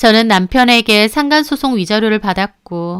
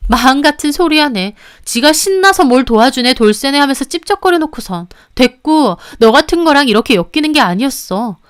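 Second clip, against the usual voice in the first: louder, contemptuous; scornful anger; 3 semitones higher, 4 dB louder than usual. Acted. Mistress rejects any relationship, scornful. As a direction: sneer him down. Quarrel in recorded phone call.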